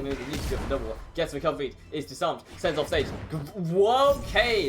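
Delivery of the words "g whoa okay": loud, high startled whoa; surprised whoa. Real, not acted. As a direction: react to a shock play. Caster surprised by play.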